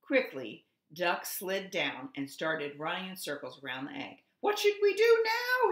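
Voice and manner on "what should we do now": high, loud holler. A narrator's reading. Duck hollers while running around the egg. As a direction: holler it in a panic. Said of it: "he hollered".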